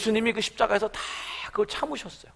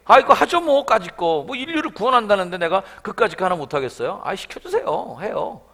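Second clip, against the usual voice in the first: louder, careless as if it were nothing; breezy offhand acceptance; 3 semitones higher, 7 dB louder than usual. Pretend. Pastor voicing an imagined casual Jesus, breezy and offhand. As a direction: shrug it off, easy-going.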